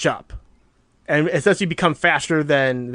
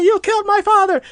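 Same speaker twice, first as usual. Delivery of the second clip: high, loud desperate yell; screaming; 4 dB louder, faster than usual. Retold or imitated. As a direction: scream it with the last strength. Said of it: "he just starts screaming it".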